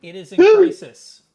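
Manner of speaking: loud, high dramatic call; dramatic announcement. Acted. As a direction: announce it with flair. GM announcing monster state.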